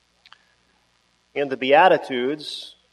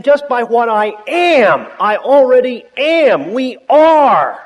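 louder, higher "We are"; preaching build; 6 semitones higher, 5 dB louder than usual. Acted. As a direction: build to a loud proclamation. Preaching climax, rising force.

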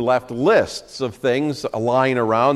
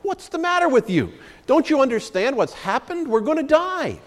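much higher, frantic disbelief; agitated panic; 13 semitones higher than usual. A narrator's reading. Stranded man panics at his calm friend, high and frantic. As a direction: squeal it like a man sure he'll die. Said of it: "became even more excited and agitated".

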